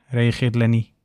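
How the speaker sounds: plain reading voice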